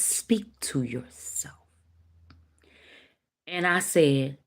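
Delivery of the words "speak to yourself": slow, whispery quote; hushed. Retold or imitated. Quoting the Bible on talking to oneself, hushed. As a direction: drop to a slow near-whisper.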